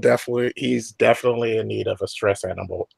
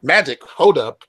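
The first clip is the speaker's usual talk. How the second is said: higher, louder disbelief; surprised; 5 semitones higher, 6 dB louder, slower than usual. Acted. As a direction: stop short in disbelief. Player reacts in disbelief.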